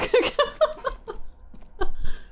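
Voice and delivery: airy, rising nervous laugh; breathless panicked laugh. Real, not acted. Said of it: "I'm panicking".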